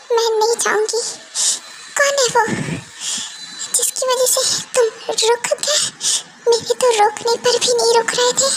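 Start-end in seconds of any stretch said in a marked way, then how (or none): 2.0-8.5 higher, tearful demand to know who she is; hurt tearful question. Acted. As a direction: demand an answer through tears. Wife confronts husband about other woman. (रोते हुए सवाल)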